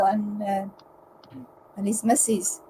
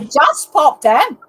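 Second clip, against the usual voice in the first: put-on loud, slow, higher Irish-accent parroting; mock Irish accent; 4 semitones higher, 8 dB louder, slower than usual. Teasing a friend's accent attempt with loud, slow mock Irish.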